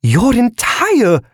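very high, slow squeaky voice; balloon stretch voice. A narrator's reading. Balloon speaks.

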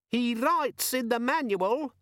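very high, slow, spiteful gadget; nasty demon. A narrator's reading. Organizer demon telling on Vimes nastily. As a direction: sneer like a tattletale. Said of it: "said the demon nastily".

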